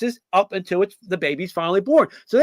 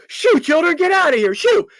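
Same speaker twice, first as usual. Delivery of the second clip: high-pitched mock shoo, waving the children off; shooing kids away; 8 semitones higher than usual.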